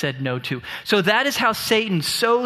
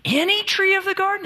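much higher, scandalized; exaggerated shock; 13 semitones higher than usual. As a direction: ham up the outrage. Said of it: "notice how he exaggerates God's prohibition".

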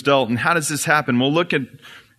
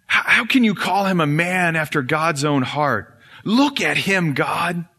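higher, scornful prosecutor's voice; Satan accusing; 6 semitones higher than usual. Acted. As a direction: list David's sins with contempt. Satan accusing King David.

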